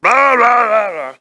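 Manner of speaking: loud, high blah-blah blare; loud American. Retold or imitated. Being the loud American across the room, blaring. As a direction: send up your own noisy chatter. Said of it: "I'm the loud American in the room".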